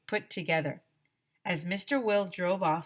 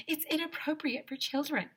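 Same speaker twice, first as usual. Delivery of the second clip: put-on prim girl voice; 9 semitones higher, 4 dB quieter than usual. Prim child Andrea objects.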